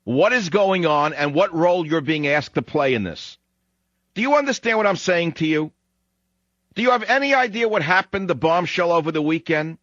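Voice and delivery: high-pitched, insistent demand to be heard; exasperated challenge. Real, not acted. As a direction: press the listeners hard, pitched well up. Host challenging listeners to wake up.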